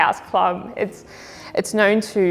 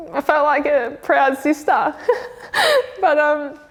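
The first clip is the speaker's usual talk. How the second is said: crying laugh; 5 semitones higher than usual. Real, not acted. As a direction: voice breaking into a teary laugh. Proud of teammate, tearing up.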